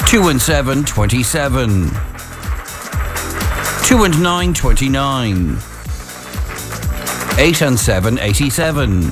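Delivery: calling out bingo numbers; each number called, then repeated; bingo caller. Acted.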